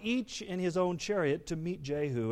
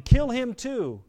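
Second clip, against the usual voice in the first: high, loud, drawn-out command; shouting; 9 semitones higher, 8 dB louder, slower than usual. A narrator's reading. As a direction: shout the order after a fleeing man.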